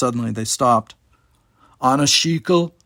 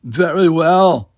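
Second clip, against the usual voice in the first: chuckling; 4 semitones higher, 7 dB louder, much slower than usual. Acted. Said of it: "Numer Ein chuckled".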